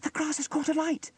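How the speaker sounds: put-on very high, urgent child voice; alarmed cry. A narrator's reading. Sarah sees fire in the grass, alarmed. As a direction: raise the alarm. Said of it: "cried Sarah".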